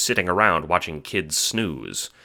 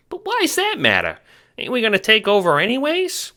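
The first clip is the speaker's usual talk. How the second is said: much higher squeaky question; 5 dB louder than usual. Acted. Henchman squeaking surprise at his boss's news. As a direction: squeak it back in alarm. Ace's voice again.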